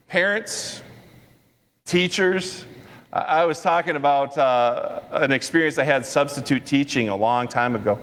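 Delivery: normal talking voice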